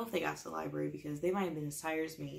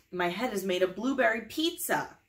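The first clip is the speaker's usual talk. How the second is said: loud silly reading; 2 semitones higher, 7 dB louder than usual. Acted.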